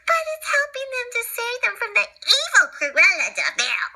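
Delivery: excited puppy. Acted.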